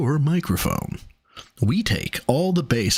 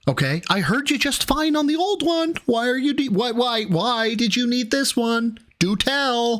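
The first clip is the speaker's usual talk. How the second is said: mocking; 8 semitones higher than usual. Mocking the mic purchase.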